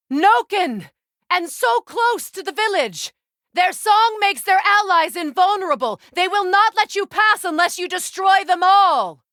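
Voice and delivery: high, loud urgent warning; alarmed battle shouts. Acted. Freya warning Kratos about the Noken, alarmed loud shouting. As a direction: call out the danger before the enemy strikes.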